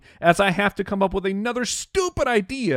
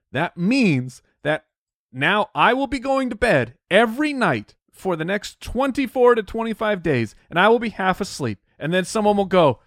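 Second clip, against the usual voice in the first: ordinary delivery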